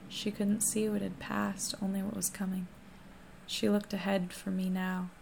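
ordinary delivery, nothing marked

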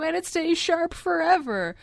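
pushy TV sales pitch; over eager infomercial. Pretend. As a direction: sell it like late-night TV. Mock sword sales pitch.

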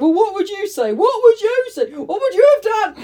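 indignant dad. Retold or imitated. Dad defending his story to his daughter, indignant. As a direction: push back like an offended father. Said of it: "Dad was like".

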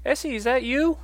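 high, loud woman's voice calling out; Martine. Acted. Woman behind Essie asks if it's her, high questioning call. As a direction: call out hopefully to someone you may know. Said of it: "you hear someone behind you say".

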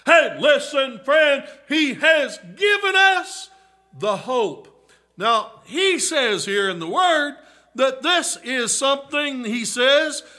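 loud, high, rising preacher's cry to the flock; full-force preaching. Acted. Preacher calling to the congregation about hope, full-force preaching. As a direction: preach it out, pleading and building.

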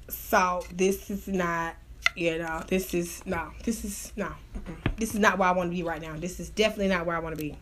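low, very soft muttering to herself; subdued refusal. Retold or imitated. Woman talking herself out of the situation, low and subdued. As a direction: quietly back out, pitch dropped right down. Retold reluctant thought, backing out.

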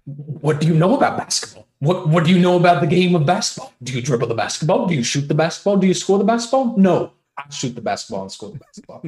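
loud, high, incredulous TV-pundit rant; a self-admitted terrible Stephen A. Smith rant; Stephen A. Smith impression. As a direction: bellow it like a fired-up sports debate host. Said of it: "I think you would be like Stephen A. Smith".

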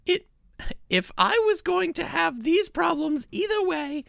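high, piercing reviewer voice; high-pitched put-on voice of an upset customer; Ginny's voice. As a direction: read her review in a high, whiny voice. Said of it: "This is what Ginny sounds like to me".

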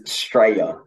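"Straya", Australia cut down to two syllables; clipped Aussie slang. Shown as a demonstration. Host quizzing the guest on slang, "Straya" said as a short form. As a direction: say "Straya" slowly, first syllable of Australia dropped. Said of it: "It's a short".

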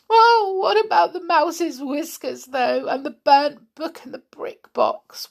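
lightly voiced weeping; wept. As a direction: doll weeping over the damage. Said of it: "wept Amelia".

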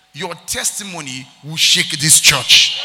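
slow, very loud prophetic preaching; booming declaration. Real, not acted. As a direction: build to a thunderous proclamation.

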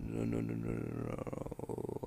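deep, slow, droning robot; low mechanical drone. Real, not acted. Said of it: "I sound like a robot".

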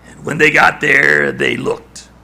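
ordinary talk

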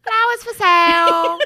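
loud, drawn-out British street seller cry; poor British accent. Acted. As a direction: holler like a British flower seller, high and slow. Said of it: "poor British people accents".

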